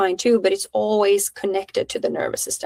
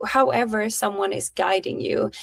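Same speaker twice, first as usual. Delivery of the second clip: usual speaking voice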